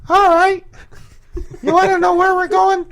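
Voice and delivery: very high falsetto impression; Will. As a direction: put on a squeaky-high tour host voice. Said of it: "Yeah, do his voice".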